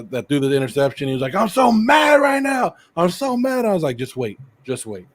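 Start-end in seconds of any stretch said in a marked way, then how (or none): 1.3-3.6 high, drawn-out mock tantrum; imitating mad son. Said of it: "my son got mad". 4.0-5.1 low, soft retold reply to a child; calm reassurance. Calming his angry son.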